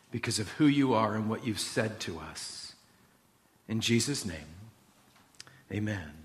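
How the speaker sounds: quiet, unhurried congregational prayer; hushed prayer settling to amen. Real, not acted. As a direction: pray softly, letting the ending drop low.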